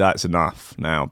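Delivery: normal talking voice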